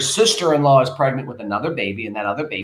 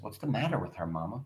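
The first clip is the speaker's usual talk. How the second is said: hushed worried question; 4 semitones lower, 14 dB quieter, faster than usual. Acted. Beneatha asks, worried.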